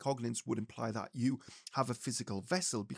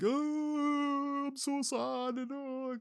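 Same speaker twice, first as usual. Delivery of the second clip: mock sobbing "oh" pitched much higher; imitating crying; 11 semitones higher, much slower than usual. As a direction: act out a spirit's grief in a high wail. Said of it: "like, crying".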